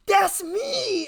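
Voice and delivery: high, loud excited cry; excited exclamation. Retold or imitated. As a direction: blurt it out in delight. Friend recognizes herself on poster.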